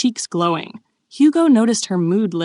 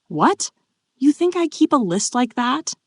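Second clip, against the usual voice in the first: baffled question, climbing higher; confused; 7 semitones higher than usual. A narrator's reading. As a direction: ask in puzzled disbelief.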